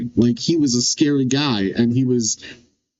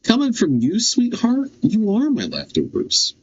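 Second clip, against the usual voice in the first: 1 mocking sweetheart jab; 9 semitones higher than usual. Playful voiced insult to Edgeworth.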